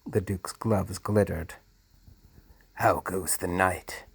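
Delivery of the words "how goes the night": ordinary delivery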